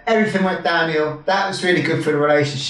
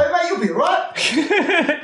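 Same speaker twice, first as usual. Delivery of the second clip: high-pitched, jokey consoling; breezy mock reassurance; 12 semitones higher than usual. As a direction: brush it off like an English mate, hammed-up. Acted English brush-off of bad news.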